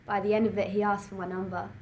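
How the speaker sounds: ordinary talk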